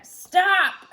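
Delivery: sudden, very loud and high Stop!; God's shout. A narrator's reading. God stopping Abraham's hand, a booming shout. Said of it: "God said".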